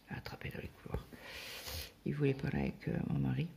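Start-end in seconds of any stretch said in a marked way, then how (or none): 0.1-1.1 fast whispered aside, almost no voice; breathy conspiratorial whisper. Retold or imitated. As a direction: hush it like a secret, quickly. Same words repeated, whispered.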